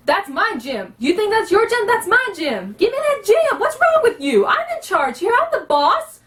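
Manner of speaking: high-pitched, indignant thug; bully who bosses everyone, voice climbing high; Buduk, abrasive and in charge. Shown as a demonstration. Half-orc thug demanding his gem as boss, abrasive and high-pitched. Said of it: "That's Buduk".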